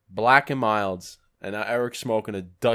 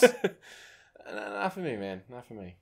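polite refusal role play; 4 dB quieter, slower than usual. Pretend. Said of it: "we're gonna role play".

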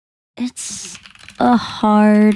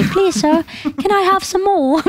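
a light mock British urchin accent, a bit higher; British accent, begging for more; Oliver Twist British; 6 semitones higher than usual. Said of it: "I sound like Oliver Twist when I say".

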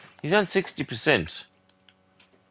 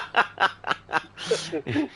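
higher-pitched real laugh; 4 semitones higher, 5 dB quieter than usual. Real, not acted. Host teasing caller about his missus, high laugh. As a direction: laugh along at the joke.

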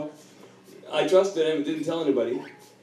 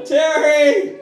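high, loud call to his wife; retold yell; 11 semitones higher, 8 dB louder than usual. Calling his wife in the kitchen, retold yell. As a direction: yell the name across the house. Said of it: "and I yell".

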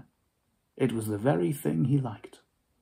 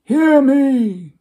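much higher, much louder ghostly command; cried out; 12 semitones higher, 10 dB louder than usual. Acted. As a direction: cry out to silence him. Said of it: "cried the ghost".

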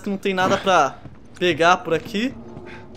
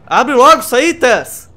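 impatient shout; 4 semitones higher, 9 dB louder, slower than usual. Real, not acted. Urging game character.

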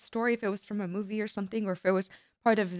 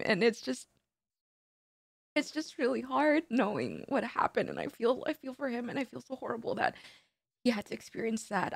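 crying; 4 semitones higher, 3 dB quieter than usual. Real, not acted. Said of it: "I'm so sorry for crying".

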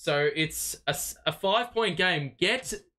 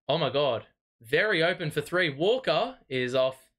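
usual speaking voice

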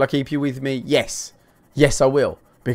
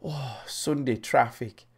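slow, soft mock groan; grumbling Brit; 9 dB quieter, slower than usual. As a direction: sigh it out like a lightly imitated weary driver. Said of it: "'Cause it's like".

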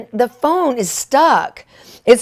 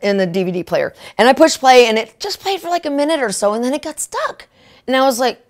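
ordinary delivery

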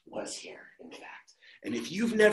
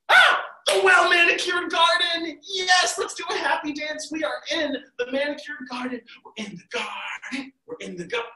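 high, squealing delight; Alice reaching the garden, squealing with joy; excited; 11 semitones higher, 3 dB louder than usual. Acted. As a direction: cheer like you finally made it.

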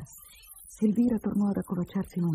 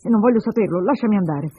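raised, impatient refusal; brusque; 4 semitones higher, 7 dB louder than usual. Acted. Man wants out of the shack, brusque. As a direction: brush her off and demand to leave. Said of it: "dissi brusco".

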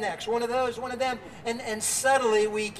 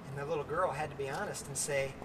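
normal talking voice

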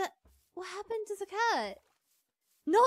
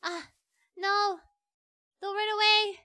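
usual speaking voice

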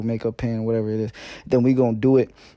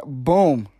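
ordinary talk

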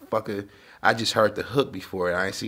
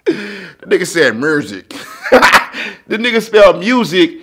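laughing; 8 semitones higher, 13 dB louder than usual. Real, not acted. Retelling cousin's spelling, laughing.

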